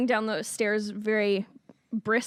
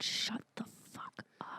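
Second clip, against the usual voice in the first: whispered curse; 17 dB quieter than usual. Retold or imitated. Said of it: "we'll be like".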